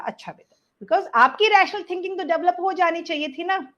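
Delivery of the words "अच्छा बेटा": low, very soft reply; calm after breath. Shown as a demonstration. Parent takes a long breath before answering a child, calm and low. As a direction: say it softly, pitch dropped, as after a long breath. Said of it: "आपने लंबी सांस ले बोला".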